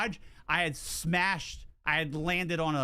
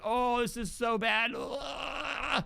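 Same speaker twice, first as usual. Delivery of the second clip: mock disgusted wife; 5 semitones higher, slower than usual. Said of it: "She's like".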